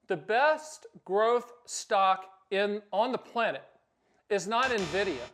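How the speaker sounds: normal talking voice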